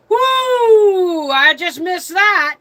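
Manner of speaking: high, loud, drawn-out whoop; retold relieved whoop. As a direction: whoop it like a driver's near miss. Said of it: "and he goes".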